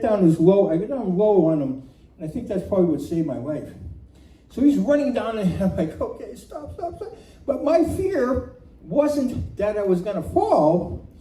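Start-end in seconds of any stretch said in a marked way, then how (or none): 6.0-7.2 high, small-voiced begging; mock panicked pleading. Rider begs galloping horse to halt, high mock pleading. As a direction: squeak out a plea, high and soft.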